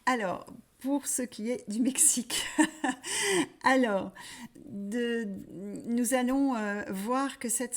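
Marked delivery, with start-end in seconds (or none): 2.5-3.6 high, loud short laugh; a bright burst of laughter. Real, not acted. She wraps up Mexico for viewers with a high loud laugh.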